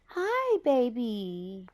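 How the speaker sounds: put-on high, drawn-out hello to a baby; cooing greeting. Grandma greeting her little grandbaby, high-pitched coo. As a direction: coo it high, then glide down.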